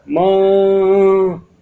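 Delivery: long, high, loud drawn-out scream of माँ; piercing scream. A narrator's reading. Daughter finds her mother dead, long anguished scream. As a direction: scream for mother in horror, stretched out. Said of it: "नव्या की जोर की चीख".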